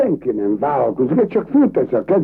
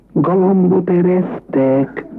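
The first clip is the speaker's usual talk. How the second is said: drawn-out announcing; slow announcement; 4 semitones higher, slower than usual. Acted. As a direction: stretch it out like a signal. Groom announces fart. (lassú, elnyújtott bejelentés)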